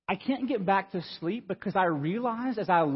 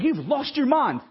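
higher, incredulous retort; retold exasperation; 6 semitones higher, slower than usual. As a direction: react as if just badly startled. Retorts to wife who startled him.

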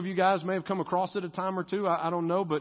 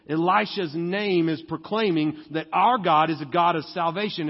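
ordinary talk

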